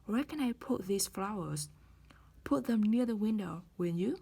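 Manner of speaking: "will you?" rising at the end; rising tag. Shown as a demonstration. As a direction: lift "will you?" upward. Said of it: "đi lên".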